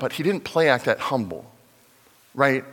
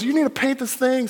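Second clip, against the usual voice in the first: excited urging; 12 semitones higher, 3 dB louder than usual. Retold or imitated. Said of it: "he goes".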